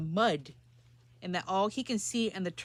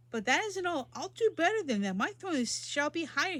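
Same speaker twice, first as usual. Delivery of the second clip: a lightly voiced proud king, higher; proud little king proclaiming; royal boasting; 7 semitones higher than usual. King Yertle boasting to turtle Mac, higher. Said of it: "his royal voice thundered".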